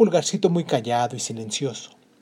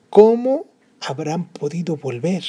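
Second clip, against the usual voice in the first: wondering father; 3 semitones higher, 5 dB louder, slower than usual. Acted.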